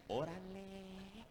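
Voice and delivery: slow, drawn-out Mexican órale; Mexican accent. Retold or imitated. Preacher imitating how he talked in Mexico, drawn-out Mexican accent. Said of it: "Yo venía hablando así".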